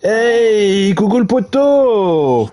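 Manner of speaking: high, long drawn-out hello; high, drawn-out friendly call; calling out a greeting. Real, not acted. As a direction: sing out to a buddy, stretching the vowels. Greeting a friend arriving in chat.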